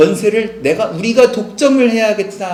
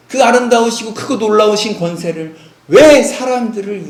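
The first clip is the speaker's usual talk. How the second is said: fervent wonder; 5 semitones higher, 5 dB louder than usual. Real, not acted. Preacher marvels at God's power.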